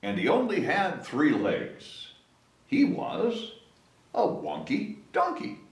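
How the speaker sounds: plain reading voice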